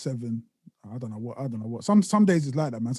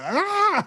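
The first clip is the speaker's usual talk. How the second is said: high, hammed-up startled cry; imitated wake-up scream; mock scream; 12 semitones higher than usual. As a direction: let out a fake attack yell. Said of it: "she was like".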